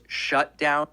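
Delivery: ordinary talk, nothing marked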